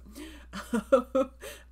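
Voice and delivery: high rising laugh; bright laugh. Real, not acted. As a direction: let a delighted laugh ring out. Laughs at dog in photo.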